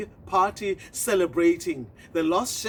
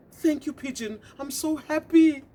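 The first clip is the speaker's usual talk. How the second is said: happy tortoise; 7 semitones higher than usual. Acted. Tortoise thanks pigeon.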